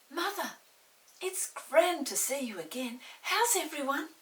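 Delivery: put-on high, loud, eager child's greeting; a child rushing to hug mother; excited child. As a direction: throw it out with joy at seeing her. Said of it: "children greeting mother".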